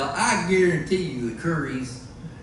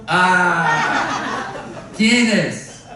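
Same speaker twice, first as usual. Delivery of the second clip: playful Spanish; 4 dB louder, much slower than usual. Pretend. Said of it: "I don't know why I want to speak Spanish".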